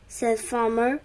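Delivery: ordinary delivery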